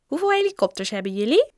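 put-on high, eager child's voice; clamouring children. A narrator's reading. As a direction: pester the rich kid with demands. Opdringerige kinderen bestoken rijke Joe. (opdringerig kind, hoge stem)

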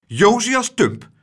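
teacher rebukes a whining grown man sternly. Acted. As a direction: scold him like an old singing teacher. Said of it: "zei juffrouw Klaterhoen streng".

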